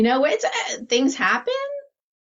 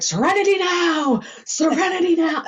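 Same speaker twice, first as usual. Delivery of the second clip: Seinfeld dad yelling; 9 semitones higher than usual. Retold or imitated. The dad from Seinfeld yelling to calm himself, frantic. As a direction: belt it like a frazzled sitcom dad. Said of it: "his dad's like".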